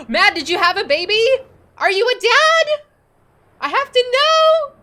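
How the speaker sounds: gasping, high and loud, thrilled; excited squeal. Real, not acted. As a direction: burst out with delighted disbelief. Streamer learns viewer had baby.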